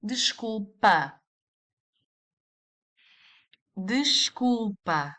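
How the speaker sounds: Desculpa drawn out slowly, the first s said as sh; slow model word. Shown as a demonstration. Teacher slowly modelling the informal Desculpa. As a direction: spell out Desculpa slowly for learners. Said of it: "you are going to say".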